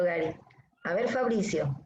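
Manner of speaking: ordinary delivery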